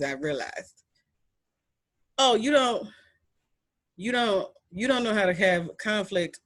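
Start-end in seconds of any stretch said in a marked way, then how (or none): none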